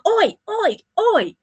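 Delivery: high, mock shouted oi; imitating a woman hollering; mock yelling oi. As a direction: call across the car park in a put-on voice. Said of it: "started yelling".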